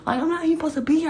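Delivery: fast, high panicky rush; paranoid panic. Retold or imitated. Retelling sneaking out, she panics that she shouldn't be there. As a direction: blurt it out in a rising panic. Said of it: "I'm paranoid".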